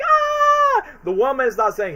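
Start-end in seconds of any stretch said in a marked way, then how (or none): 0.0-0.9 high, loud "Ah!" yelp; imitating Kevin's high scream at the pigeons; mock scream of a kid. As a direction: yelp in fright like a scared boy. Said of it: "Once when Kevin's like".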